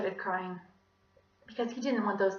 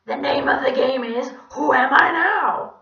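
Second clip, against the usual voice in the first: creepy French donkey voice; 6 semitones higher, 14 dB louder than usual. Acted. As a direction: taunt like a hoarse French donkey. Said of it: "his normal creepy French donkey voice".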